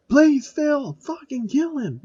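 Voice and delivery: high squeaky pleading voice; players screaming. A narrator's reading. Players beg Phil to kill Giant Dad, high pleading squeal. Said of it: "the players scream in pain".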